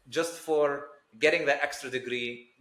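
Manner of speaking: ordinary talk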